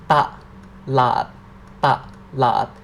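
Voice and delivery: "Ta, lat" slowly spelled out syllable by syllable; Thai syllables ta lat. Shown as a demonstration. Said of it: "Repeat that again".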